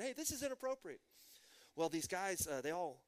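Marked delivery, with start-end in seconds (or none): 0.0-1.0 half-hearted high holler; retold yell. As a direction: imitate an indignant scolding, raised in pitch. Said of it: "she yelled at them".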